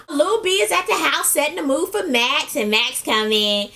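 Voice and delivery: usual speaking voice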